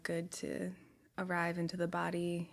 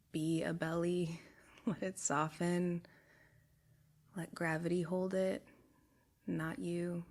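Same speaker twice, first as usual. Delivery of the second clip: calm body-scan voice, long pauses between phrases; guided meditation. Real, not acted. Guide taking a group through a body scan, soothing meditation voice. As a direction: guide them unhurriedly, letting each phrase settle.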